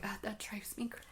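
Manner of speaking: breathy mocking whisper. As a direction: whisper it like a complaint. Mimicking people who dislike ASMR.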